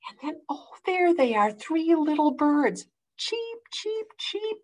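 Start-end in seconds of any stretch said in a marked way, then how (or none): 3.2-4.6 very high, soft chick cheeps; baby bird cheeps. Acted. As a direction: peep it like tiny chicks. Baby birds chirp.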